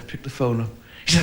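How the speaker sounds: ordinary talk, nothing marked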